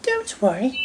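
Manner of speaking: high, soft lady's reassurance; soft high female character; smiling Lady Hat. A narrator's reading. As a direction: reassure gently with a smile, pitched high. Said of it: "smiled Lady Hat".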